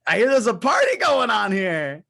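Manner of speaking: in-character hollered greeting; calling out. Acted. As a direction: call across to a distant group. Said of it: "in shouting range where they would be able to hear me if I sh-, shouted".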